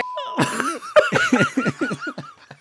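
squealing high-pitched laughter; helpless high laugh; high giggle fit. Real, not acted. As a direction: break into a shrieking giggle. Still cracking up.

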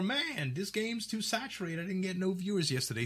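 high put-on whiny voice; complaining streamer. Streamer grumbling to peers about views, high whiny voice. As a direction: moan about getting no viewers.

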